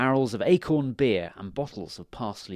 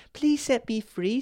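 tiny fairy voice; 12 semitones higher, 4 dB louder than usual. Acted.

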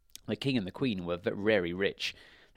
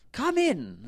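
high, loud king voice; story king; 12 semitones higher, 5 dB louder than usual. A narrator's reading. King invites the wizard inside, high loud call. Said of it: "said the king".

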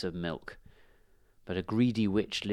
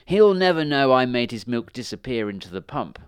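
louder, lightly witchy gloat; gloating witch; 3 semitones higher, 8 dB louder than usual. A narrator's reading. Witch boasting about stolen milk, gloating. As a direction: crow over the secret trick, louder. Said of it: "chuckled the witch".

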